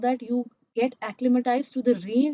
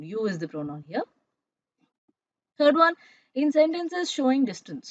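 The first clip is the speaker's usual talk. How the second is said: ordinary talk, nothing marked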